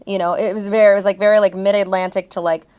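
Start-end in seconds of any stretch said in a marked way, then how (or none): none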